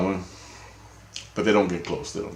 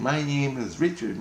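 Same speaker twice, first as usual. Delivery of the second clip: put-on high, childlike inner voice; innocence voice; 6 semitones higher, 3 dB quieter than usual. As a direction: speak as a small inner child. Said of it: "my innocence is talking".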